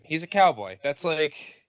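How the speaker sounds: ordinary delivery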